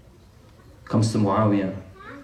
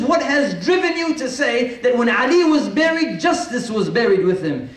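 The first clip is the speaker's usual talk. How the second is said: incredulous outburst; 9 semitones higher than usual. Acted. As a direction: fling the question up in disbelief. Muawiyah demands explanation, incredulous.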